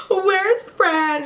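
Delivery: gasp, then ask again through mock tears; mock crying with a gasp. Big Key crying while looking for Fran, gasping mock sob. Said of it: "And she's crying her eyes out".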